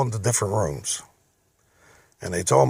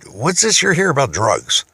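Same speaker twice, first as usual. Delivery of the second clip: higher and louder, indignant disbelief; incredulous demand; 5 semitones higher, 5 dB louder than usual. Retold or imitated. Owner confronts raiding officers, incredulous and loud. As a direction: push back hard on the accusation. Confronting police at his business.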